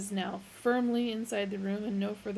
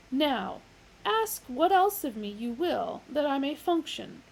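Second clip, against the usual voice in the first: booming, measured machine voice; grand computer voice; 6 semitones higher, 6 dB louder than usual. Acted. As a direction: pronounce it like a vast computer. Deep Thought's voice.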